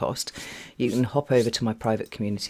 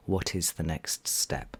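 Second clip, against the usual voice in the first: slow whisper; 5 semitones lower, 6 dB quieter, slower than usual. Real, not acted. Stressing the key question softly.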